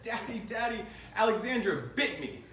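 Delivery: high-pitched imitation of an upset small child; mock-distressed kid calling for Daddy; tattling little girl. Little daughter runs upstairs to tell dad on her sister, mock upset.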